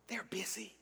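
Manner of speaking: put-on hushed child's whisper; whispering little boy. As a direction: whisper it like a small boy hiding. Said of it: "He was whispering".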